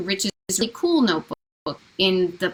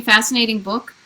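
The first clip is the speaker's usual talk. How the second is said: normal talking voice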